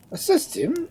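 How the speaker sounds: sharp, high call for help; urgent cry. A narrator's reading. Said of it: "cried the Duke".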